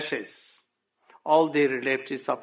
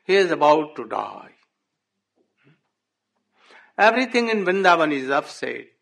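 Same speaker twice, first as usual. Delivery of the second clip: louder, rushed despair over the village; grieving lament; 5 dB louder than usual. Acted. Nanda Baba telling Uddhav of Vrindavan's ruin, in louder despair.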